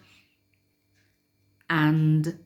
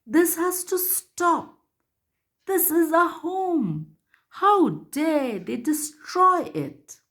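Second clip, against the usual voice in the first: roaring angry lion; 12 semitones higher than usual. Acted. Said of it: "The lion roared".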